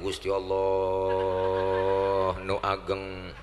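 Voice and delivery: low, drawn-out mock Sundanese takbir; takbir ala Sunda. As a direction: stretch the Sundanese prayer call long and low, softly. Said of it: "Orang Sunda takbir". (takbir logat Sunda, rendah dan panjang)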